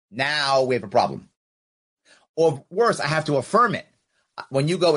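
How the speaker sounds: normal talking voice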